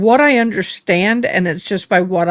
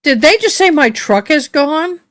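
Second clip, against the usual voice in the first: sudden high, louder disbelief; startled question; 9 semitones higher, 3 dB louder than usual. Real, not acted. Streamer reading odd courtroom captions, surprised. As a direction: blurt it out, caught off guard.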